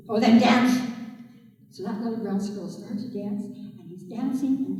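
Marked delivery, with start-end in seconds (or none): 0.1-0.8 loud, blunt command; gruff order. A narrator's reading. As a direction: order the squirrel to dance. Man ordering squirrel.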